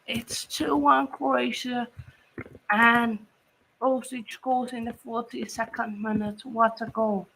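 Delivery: ordinary talk, nothing marked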